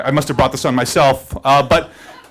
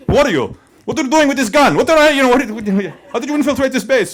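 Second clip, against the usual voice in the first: mock interrogation; 7 semitones higher than usual. Imagined interrogator grilling the teller about the gun, high mock stern. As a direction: grill the suspect, pretend stern.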